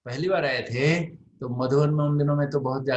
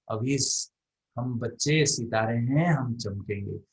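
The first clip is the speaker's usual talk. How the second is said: solemn preaching voice; 5 dB louder than usual. Retold or imitated. Baba's message voiced as sermon.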